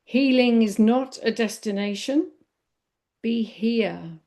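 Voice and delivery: measured poem reading in short, separated phrases. Real, not acted. Meditation teacher reading a poem on healing to listeners, poem reading. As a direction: let each phrase land, with lifts on key words. I'm going to read a poem.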